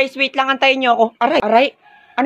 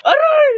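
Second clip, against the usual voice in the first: yelp of pain; 5 dB louder than usual. Acted. Slapped in a fight.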